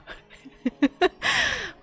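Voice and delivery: loud high falling laugh; burst of laughter. Acted. Recalling the sister's chocolate prank, loud laugh.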